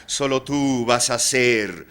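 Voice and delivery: loud, high, theatrical declamation; grand proclamation. Acted. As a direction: proclaim it grandly, drawing out the final words. Proclaims Oscar will be immortal. (proclamación teatral, fuerte y aguda)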